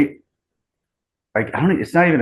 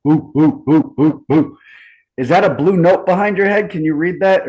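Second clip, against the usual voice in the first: ooh-ing high and louder, teasing; playful excitement; 7 semitones higher, 5 dB louder than usual. Real, not acted. High, teasing, louder ooh-ing. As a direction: teases his friend about the thing behind his head, excited. Spots something behind friend's head.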